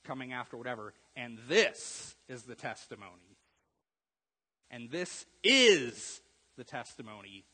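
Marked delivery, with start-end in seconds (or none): 1.2-3.4 "this" stressed louder and slower in "And this is the testimony"; slow read, weight on "this". Shown as a demonstration. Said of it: "put the emphasis on a different word". 4.7-7.5 "is" hit loud and drawn out, slow delivery; stress on IS. Shown as a demonstration. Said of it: "put the emphasis on a different word".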